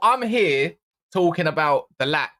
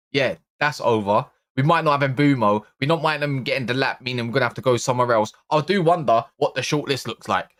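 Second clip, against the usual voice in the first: usual speaking voice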